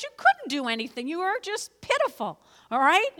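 ordinary delivery

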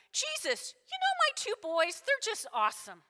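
high-pitched boasting that drops at the end; doting mom. Acted. Mom presenting her sons to Jesus, proudly gushing. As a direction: brag sweetly on your boys. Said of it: "And she goes".

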